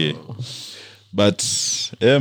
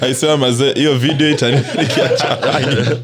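laughing; 11 semitones higher, 3 dB louder than usual. Real, not acted. Friend laughing, teasing hangs him.

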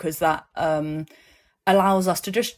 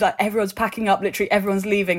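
usual speaking voice

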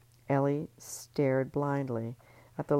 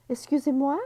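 high, loud offended French; French accent; 12 semitones higher, 9 dB louder than usual. Acted. Marie Claire bristling at Ellie in French accent.

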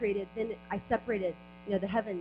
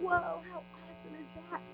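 awed whoa; 6 semitones higher, 4 dB quieter than usual. Retold or imitated. Awed thought while preaching.

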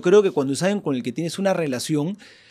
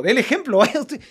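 higher, mock insistent crowd voice; pushy parishioner voice; 7 semitones higher than usual. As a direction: needle the priest to go first. Parishioners pushing the priest.

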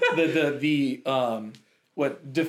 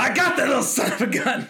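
pitched-up put-on voice; mock gunman; 6 semitones higher than usual. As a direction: gloat in a hammed-up voice. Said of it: "smoking Beretta".

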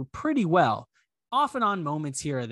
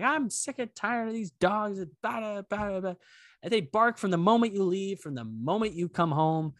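higher-pitched imitation of a fed-up neighbor; mock angry complaint; 6 semitones higher than usual. Retelling the neighbor's phone complaint, mildly mock angry. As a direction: lightly act out a ranting complainer. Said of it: "this woman is yelling at him".

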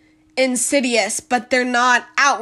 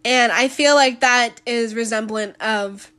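ordinary delivery

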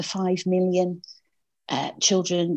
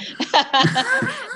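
laughing; 3 dB louder than usual. Real, not acted. Laughs at own accent.

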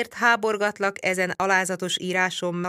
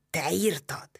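breathless, toneless whisper of disbelief; stunned whisper. A narrator's reading. Csát learns the narrator wrote the letter, shocked whisper. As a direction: hiss it in shock. Csát hears the forgery confession.

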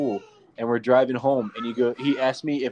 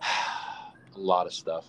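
slow and a little low, with a sigh and a laugh; weary kid sigh; 6 semitones lower, 5 dB quieter, much slower than usual. Retold or imitated. Dad imitating his son's answer with a slow sigh. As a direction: weigh the words down like a tired little boy. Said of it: "he just looks at me and goes".

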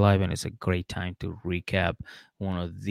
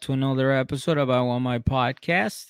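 big bright on-air welcome; morning show host; 5 semitones higher, 10 dB louder than usual. Acted. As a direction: boom it out like a radio host. Podcast host opens the show.

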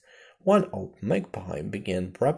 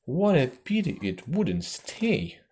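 slow, higher-pitched lament; sighing regret; 5 semitones higher, 4 dB louder than usual. A narrator's reading. Lory as the mouse leaves, sighing with regret. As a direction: sigh it out slowly, mildly regretful. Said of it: "sighed the lorry".

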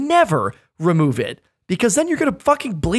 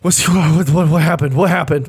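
groggy alarmed wife; 4 dB louder than usual. Retold or imitated. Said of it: "She goes".

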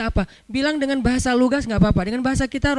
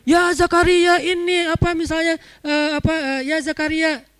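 high-pitched fervent khatib imitation; over-eager preacher's high tone; 6 semitones higher than usual. Shown as a demonstration. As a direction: keep every word up at a high pitch. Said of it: "semuanya nadanya tinggi".

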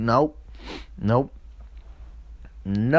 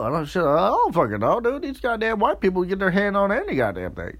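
ordinary talk

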